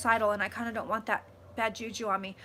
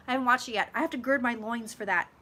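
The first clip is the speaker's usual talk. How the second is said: ordinary delivery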